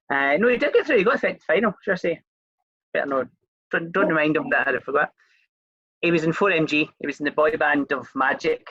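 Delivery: ordinary talk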